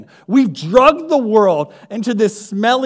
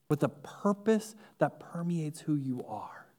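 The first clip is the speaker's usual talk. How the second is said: whispered, intimate; 4 semitones lower, 16 dB quieter than usual. Acted. Preacher whispers to listeners about their eternal purpose, hushed. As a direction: let it fall to a whisper.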